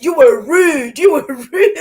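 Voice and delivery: loud scolding that breaks into laughter; mock telling-off, laughing. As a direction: crack up while calling out rudeness.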